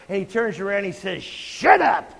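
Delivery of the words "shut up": sharp loud rebuke, pitched up; acted shout. Jesus rebuking his best buddy Peter, a sharp shout. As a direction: snap it out like a furious rebuke.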